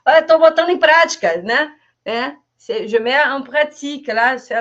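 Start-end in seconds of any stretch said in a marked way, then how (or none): 0.1-1.8 proud reply. Pretend. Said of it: "proud reply".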